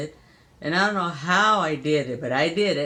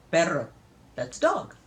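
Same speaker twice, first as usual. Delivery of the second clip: normal talking voice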